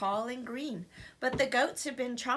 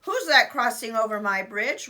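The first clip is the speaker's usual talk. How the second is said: troll voice; 8 dB louder than usual. Acted. Troll challenge.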